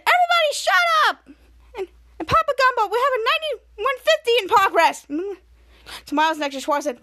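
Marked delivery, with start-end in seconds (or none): 0.0-5.1 very high, loud order to the team; bossy command. Retold or imitated. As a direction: silence the room and take charge. Hero commanding the group.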